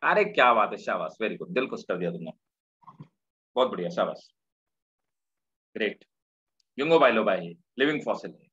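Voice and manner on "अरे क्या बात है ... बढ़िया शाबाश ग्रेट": warm praise. Real, not acted. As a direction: lean in and praise the student gently. Student answers correctly, teacher praises.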